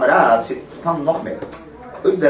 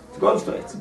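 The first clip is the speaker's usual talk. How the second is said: hushed repeat; 4 dB quieter, much faster than usual. Shown as a demonstration. Repeating the point to his study partner, in a soft whisper. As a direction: drop to a breathy whisper for the second pass.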